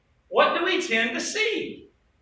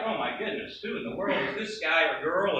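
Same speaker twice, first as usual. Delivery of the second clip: low, quiet exasperated aside; mock frustration; 8 semitones lower, 6 dB quieter than usual. Preacher imitating people around a new believer, mock frustrated. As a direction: grumble it under your voice, lightly exasperated. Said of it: "like, frustrated".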